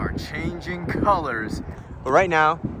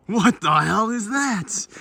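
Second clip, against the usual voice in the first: higher, slow startled cry; mock alarm; 10 semitones higher, 4 dB louder, slower than usual. Real, not acted. Friend in the dark fakes a startled cry. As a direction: jump at a shape in the dark.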